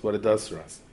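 usual speaking voice

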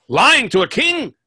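high, loud, slow royal outrage; lion king bellowing an accusation; king's roar. Acted. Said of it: "He goes".

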